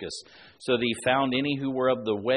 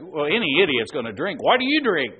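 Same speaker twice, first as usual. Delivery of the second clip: threatening demo; 3 semitones higher, 7 dB louder than usual. Shown as a demonstration. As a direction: demand it like an insulting accusation, rising sharply. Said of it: "say it in a threatening way".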